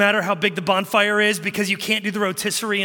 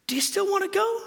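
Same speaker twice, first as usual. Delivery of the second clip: high, softened dad voice; sudden high-pitched coaxing; coaxing question; 11 semitones higher than usual. Retold or imitated. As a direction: ask your child in a high, hopeful lilt. Dad coaxing son after warning.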